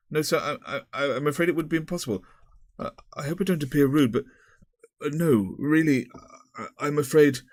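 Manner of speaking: flustered refusal. A narrator's reading. Paul refuses the headmaster, flustered and stammering. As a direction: tumble out an awkward no.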